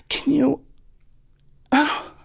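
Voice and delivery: a lightly voiced high, small Paula; dainty Paula. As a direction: ask sweetly and helplessly, barely above a breath. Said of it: "And she's like".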